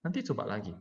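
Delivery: soft, unforced demonstration of patience; speaking gently. Shown as a demonstration. Parent to a child who can't do it yet, gently and softly. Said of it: "Ngomongnya pelan-pelan".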